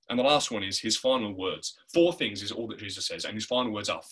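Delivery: ordinary talk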